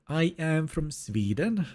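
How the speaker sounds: slow, mock Swedish accent. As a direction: imitate a Swede's English, drawn out. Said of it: "people from Sweden talking like this".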